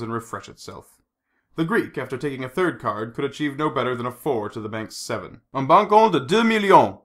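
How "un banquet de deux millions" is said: raised, ringing French croupier call; casino croupier. A narrator's reading. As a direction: call the stakes out to the table. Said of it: "said the croupier".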